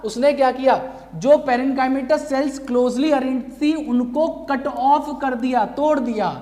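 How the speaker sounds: usual speaking voice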